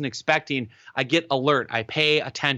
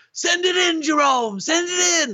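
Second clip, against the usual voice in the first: high excited sports announcer yell; hammed-up announcer shouting the dunk; announcer scream. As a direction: call it like a thrilled announcer on TV. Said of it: "screamed out".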